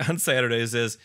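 ordinary delivery

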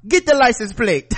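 a hint of a Jamaican accent, giving an order; mock Jamaican husband. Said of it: "he was like".

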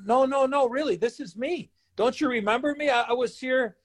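high-pitched, insistent protest; retold pleading. Rookie begging the security guy to recognize him, pleading.

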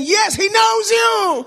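high, long-drawn triumphant yell; preacher's shout. Retold or imitated. Preacher confirming God knows him, shouted high and long. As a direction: shout it out and hold the last word.

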